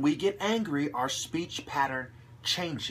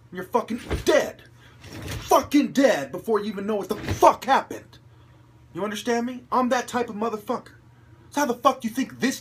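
loud, high furious threat; menacing threat; 6 semitones higher, 4 dB louder than usual. Real, not acted. Ranting at his critics, loud and menacing. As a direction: threaten them like a killer.

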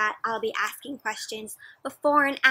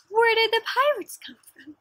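high, loud, wide-eyed question; amazed read-aloud question; excited question; 11 semitones higher, 6 dB louder than usual. Shown as a demonstration. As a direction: ask with big wonder. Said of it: "asking child".

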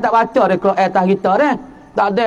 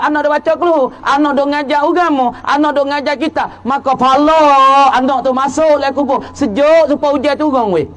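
higher, emphatic sermon voice; fervent preaching; 5 semitones higher than usual. Acted. Preacher describing reward flowing into the grave, fervent. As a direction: proclaim the reward passionately.

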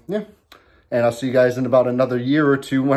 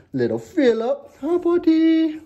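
talking to dog; slower than usual. Real, not acted. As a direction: coo at the little dog. Greets his dogs.

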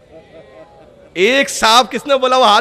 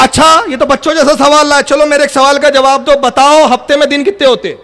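higher and louder, lightly teasing; amused counter-question; 5 semitones higher, 5 dB louder than usual. Retold or imitated. Ali answering the riddle with a teasing counter-question. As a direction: toss a childish question back. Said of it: "علی نے جواب کیسا دیا سنیں".

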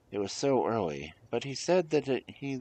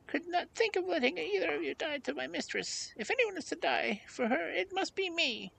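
tiny, very high-pitched dog voice; loyal little dog. Acted. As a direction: insist loyally in a squeaky dog voice.

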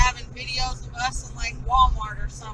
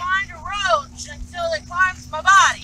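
loud disgusted complaint; 6 semitones higher, 7 dB louder than usual. Real, not acted.

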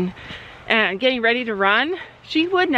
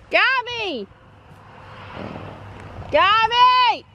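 high call across the barn; 11 semitones higher, much slower than usual. Real, not acted. Calling Gabby across the yard, high. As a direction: call her friend from afar, high.

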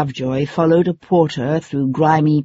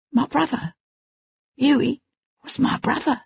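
put-on high, softer boy's voice, close to tears; grieving boy; 9 semitones higher, 5 dB quieter than usual. A narrator's reading. Boy tells his lord about his hanged brother, tearful. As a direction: say it quietly through grief.

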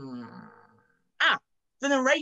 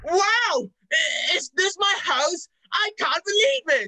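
much higher, louder amazed cries; 7 semitones higher, 5 dB louder, slower than usual. Acted. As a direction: burst out in delighted disbelief. Amazed to be home.

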